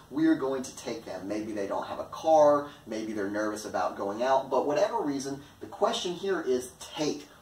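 ordinary delivery

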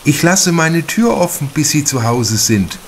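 a concerned hostess pleading gently; worried mother. A narrator's reading. Mother offers to leave door open.

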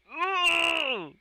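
very high, loud squeal; piercing excited whoop; shrieking laugh. Real, not acted. Shrieks at the confession.

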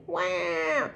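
high mock baby wah; light imitated baby cry; baby wail. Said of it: "A baby crying".

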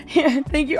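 ordinary talk

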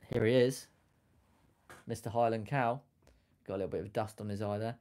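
normal talking voice

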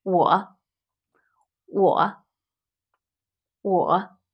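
我 said low and dipping. Shown as a demonstration. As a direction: sink 我 into a low dip. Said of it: "A low tone".

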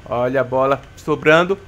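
ordinary talk

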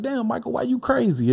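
high-pitched imitation of people calling him crazy; everybody be like. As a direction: squeak it out like the crowd mocking him. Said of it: "everybody be like".

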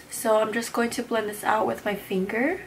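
normal talking voice

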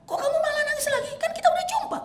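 very high, put-on voice asking kindly; puzzled gentle question. Prophet gently asking the weeping Thauban, high puzzled voice. Prophet asks why he still cries.

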